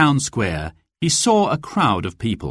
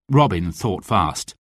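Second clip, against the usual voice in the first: plain reading voice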